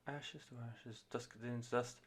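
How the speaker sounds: very soft, gentle, hushed line; gentle female vocal. Retold or imitated. Reviewer imitating the gentle lead singer, soft and hushed. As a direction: breathe it out tenderly like the lead singer. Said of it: "vocalist in it is obviously being, like, very gentle".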